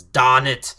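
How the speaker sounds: put-on loud Jorgen-style thick accent, R dropped. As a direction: say "darn" with a thick accent, like "dahn". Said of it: "he says it with a very thick accent".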